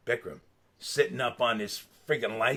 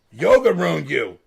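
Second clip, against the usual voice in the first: loud, slow repeat of a punchline; hammed-up verdict, slow and loud; mock accusation; 3 semitones higher, 10 dB louder, slower than usual. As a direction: declare it loudly and draw it out.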